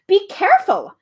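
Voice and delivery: high, loud cry of alarm; shouted warning. Acted. Milo warns the bee of the cane, high loud shout. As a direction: yell a sudden warning. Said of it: "shouted Milo".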